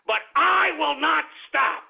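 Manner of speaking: a slow defiant promise; defiant vow. Real, not acted. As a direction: lean hard into each word. Defiant vow on radio rant.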